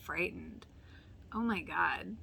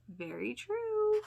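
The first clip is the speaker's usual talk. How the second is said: high, drawn-out "very true"; emphatic agreement; 6 dB louder, slower than usual. Real, not acted. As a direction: stretch it out high and knowing. Agreeing with show quote.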